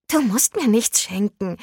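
ordinary delivery, nothing marked

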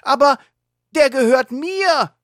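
high, loud protest; shouting. Acted. Catboy shouting at the wolf kids stealing his car. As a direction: cry out that it's yours. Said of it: "schreit Catboy".

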